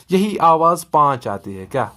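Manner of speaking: normal talking voice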